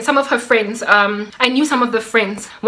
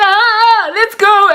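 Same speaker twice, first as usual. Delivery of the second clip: high, loud eager chant; rally your date to head out; hammed-up cheer, pitched way up; excited party hype; 9 semitones higher, 5 dB louder than usual. Replays her eager party chant.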